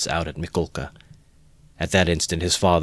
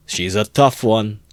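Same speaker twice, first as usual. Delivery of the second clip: slow, louder jeer; crowd shout; 4 semitones higher, 6 dB louder, slower than usual. A narrator's reading. Onlooker in the crowd, slow jeering shout. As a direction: call it out with grim relish. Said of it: "was shouted in the crowd".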